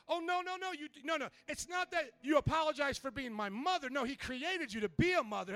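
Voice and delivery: high, hurried mock reassurance; lightly voiced daughter correcting mom; protesting daughter. Daughter hastily corrects angry mother.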